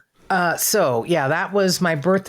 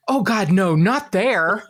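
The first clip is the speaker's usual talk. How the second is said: higher, mock-horrified objection; Keith Morrison; 5 semitones higher, slower than usual. TV idol hears fan's hotel, high mock horror. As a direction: recoil with the pitch lifted. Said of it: "And he goes".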